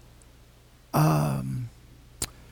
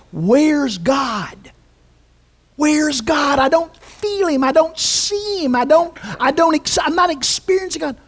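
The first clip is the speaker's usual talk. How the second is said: higher, pleading cry of doubt; complaining believer; 5 semitones higher than usual. Retold or imitated. Preacher voicing a doubting believer, pleading and higher. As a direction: voice the frustrated seeker, rising. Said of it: "the way we say this".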